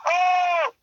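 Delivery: high mock-excited exclamation; hammed-up sportscaster cry; Dick Vitale. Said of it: "He's like".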